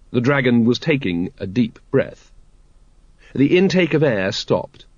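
ordinary delivery, nothing marked

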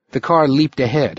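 plain reading voice

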